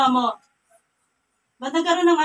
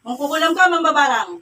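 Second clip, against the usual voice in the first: forceful, raised-voice charge; loud accusation; 6 dB louder than usual. Real, not acted. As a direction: accuse them to their face, loudly. Accuses the spirit of witchcraft. (malakas na pambibintang)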